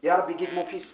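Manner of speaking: low, soft plea to God; quiet prayer. Retold or imitated. Mother quietly praying for her rude son.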